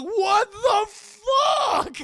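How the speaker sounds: high, very slow horrified shout; imitated freaked-out scream. Storyteller voicing onlookers after mallet blow, freaked-out screaming. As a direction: stretch it into a shocked mock scream. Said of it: "Everyone's, like, really freaked out".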